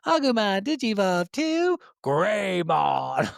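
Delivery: high, pumped anime transformation call; anime announcer. Retold or imitated. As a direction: shout it like the cartoon's evolution sequence. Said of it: "it's like".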